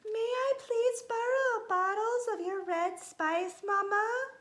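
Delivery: high-pitched, loud little kitty voice; polite kitty boy. A narrator's reading. Kitten asks Mama to borrow spice, polite and high-pitched. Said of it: "he asked politely".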